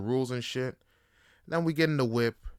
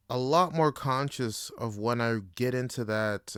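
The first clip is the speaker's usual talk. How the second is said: ordinary talk, nothing marked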